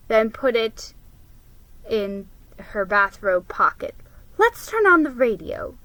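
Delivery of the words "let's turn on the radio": higher, bright mother's suggestion; change the mood for the kids; lightly voiced cheerful mom. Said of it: "she said".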